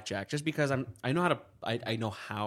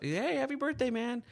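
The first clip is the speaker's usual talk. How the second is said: a higher, cheerful dad on the phone; dad's voice; 13 semitones higher than usual. Retold or imitated. Dad calling his son on his birthday, lightly voiced cheerful. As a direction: pitch it up as the upbeat dad.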